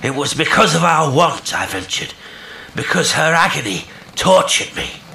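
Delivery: high, loud, anguished reproach; reproachful. A narrator's reading. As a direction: call it back as a pained reproach. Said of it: "in a tone which seemed a merited reproach".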